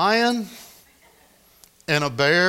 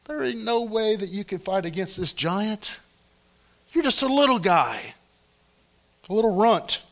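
a hint of a scornful Saul, pitched higher; mock Saul sneering at a little runt; scoffing Saul; 7 semitones higher than usual. Saul scoffing at little David, pitched higher and sneering. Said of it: "Saul has just said".

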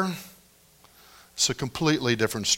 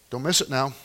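ordinary talk, nothing marked

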